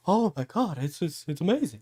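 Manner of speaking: gasp, then high and breathless with wonder; a fan stunned by the big moment; hammed-up gasp of amazement; mock awed fan. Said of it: "They're like".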